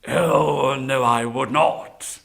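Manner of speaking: slow, drawn-out tiger denial; reassuring tiger. Acted. Tiger promising the Brahmin safety, reassuring. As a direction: deny it slowly, with a loud opening.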